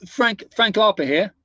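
put-on a light East End London gangster accent. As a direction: greet in a Cockney gangster accent. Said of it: "East End of London gangster accent".

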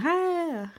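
high, breezy casual hi; imitating cousin's hello. Cousin arriving unannounced, mimicked with a high casual hello. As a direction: toss off a light, high hello. Cousin drops in greeting her.

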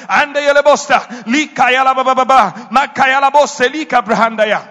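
loud, rapid tongues; speaking in tongues. Real, not acted. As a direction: pour out tongues in fervent prayer.

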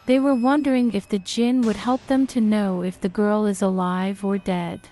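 usual speaking voice

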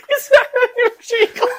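high, loud words squeezed out through laughter; helpless laughter. Real, not acted. Telling the cohost he is doomed, helplessly laughing.

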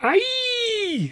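very high, long drawn-out wail; keen it out like a tragic heroine; hammed-up high keening cry; melodramatic wail. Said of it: "she wailed again".

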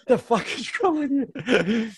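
high, loud, drawn-out mock yell; retold outraged disbelief. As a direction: imitate an alarmed cyclist yelling back, half laughing. Cyclist yells at his naked pursuer.

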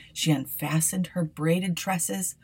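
plain reading voice

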